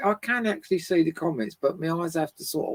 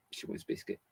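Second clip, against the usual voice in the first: whispered aside for the dog; 7 semitones lower, 15 dB quieter than usual. Pretend. Voicing what her dog wants, whispered. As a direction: whisper it so the dog can't hear. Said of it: "That's what she's saying".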